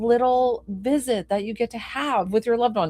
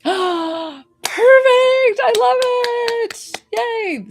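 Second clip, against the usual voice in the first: very high, drawn-out happy squeal; gasp, then squeal with delight; 7 dB louder, much slower than usual. Real, not acted. Delighted that her reading landed.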